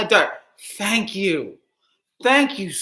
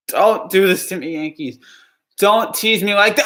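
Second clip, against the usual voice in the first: ordinary delivery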